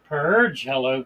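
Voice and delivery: ordinary talk, nothing marked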